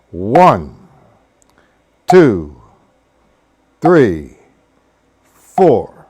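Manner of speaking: slow, spaced-out count demo; steady slow count with a gap after each number. Shown as a demonstration. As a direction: count one, two, three, four slowly with long gaps. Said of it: "That's how slow I would do it".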